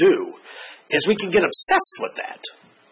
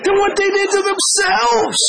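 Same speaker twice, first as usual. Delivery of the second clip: high, rising incredulous question; amused disbelief; 11 semitones higher than usual. Retold or imitated. Pastor answering the angry parent, high amused disbelief. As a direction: ask it wide-eyed, slowing at the end. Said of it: "I said".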